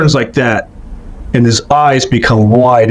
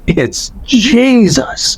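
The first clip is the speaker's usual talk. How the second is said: slow, higher voiced cry of wonder; stunned recognition, aloud; awed; 8 semitones higher, much slower than usual. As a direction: let it out slowly, falling in awe. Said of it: "all I could say was".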